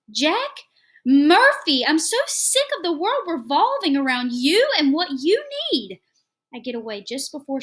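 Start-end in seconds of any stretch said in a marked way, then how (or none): 0.1-6.0 much louder, higher, slowed yell at husband; yelling wife. A narrator's reading. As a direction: shout it at her husband, fed up. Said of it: "I hear her yelling".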